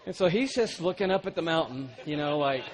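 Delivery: loud, driving preacher's storytelling; preaching at full force. Acted. Preacher to congregation, loud full-force delivery. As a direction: preach it big to the crowd.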